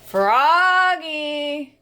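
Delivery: loud, drawn-out call from far away. Acted. Mother calling Froggy back from the door, loud. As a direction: call him from across the house. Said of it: "called his mother".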